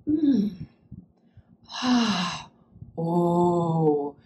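therapist listening sounds. Retold or imitated. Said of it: "making sounds like".

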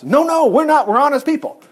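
high-pitched, earnest denial; defensive protest; acted protest. Brothers protesting the spy charge.

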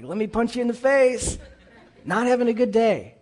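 high, loud comic exasperation; mock fed-up retort. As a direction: snap back in mock anger. Acts fed-up retort to cheerer-up.

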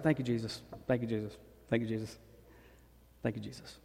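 soft, fading murmured thanks; quiet prayer. Retold or imitated. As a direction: pray it under your breath. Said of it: "I actually started praying".

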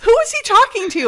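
high, puzzled outburst; baffled question. Retold or imitated. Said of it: "I was just like".

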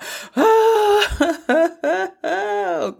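high, hammed-up sobbing wail half-laughing; mock wailing cry. Shown as a demonstration. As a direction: play a big ugly cry for laughs. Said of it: "a cry where it's like".

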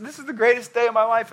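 high, fast burst of delight; acted giddy happiness, pitch way up; overjoyed. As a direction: gush it out, thrilled. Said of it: "He's just so happy".

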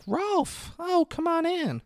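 very high put-on voice; mock female greeting. Imitating Sally welcoming Rolf at the door, high mock greeting. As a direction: imitate a woman in a high pitch. Acts woman greeting visitor.

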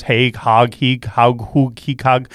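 playing with Haag as Heeg, Hoog, Haag; deliberately mangled Haag, Heeg, Hoog; mock mispronouncing. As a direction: toy with the name, trying vowels. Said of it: "or whatever your name is".

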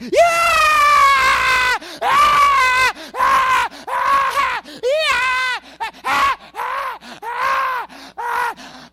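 very high shrieking yells of joy; mock roller-coaster screaming; wild excitement. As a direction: scream like you're on a ride. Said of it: "and you're like".

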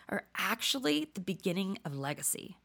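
ordinary delivery

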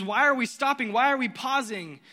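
a desperate dad demanding to keep moving; panicked father. Retold or imitated. Jairus begging Jesus not to stop, frantic and loud. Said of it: "Jairus is probably freaking out".

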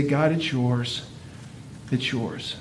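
hushed, fading release to God; soft surrender prayer. Pretend. Giving all the hurt to God, in a hushed prayer.

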